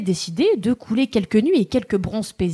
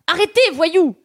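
raised, scolding cry; indignant shout; 7 semitones higher than usual. Retold or imitated. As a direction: scold them like a prude. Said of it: "en proférant des menaces".

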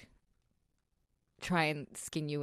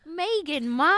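mock Cockney question, higher and rising; 10 semitones higher, 4 dB louder than usual. Co-host joins the joke in mock Cockney. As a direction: play along in a silly Cockney accent. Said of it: "Why do we have Cockney accents?".

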